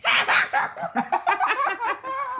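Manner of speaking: high, loud forced giggle; staged giggle for the camera; fake laugh on request. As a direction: laugh on cue, hammed up. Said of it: "How about laughing?".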